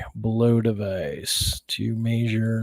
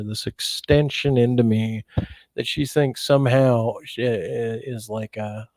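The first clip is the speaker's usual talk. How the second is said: usual speaking voice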